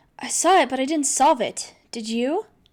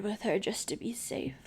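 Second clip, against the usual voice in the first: whisper; 12 dB quieter than usual. Acted. Aside said under the breath.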